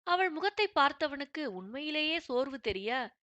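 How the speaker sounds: ordinary delivery, nothing marked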